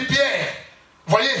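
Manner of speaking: ordinary delivery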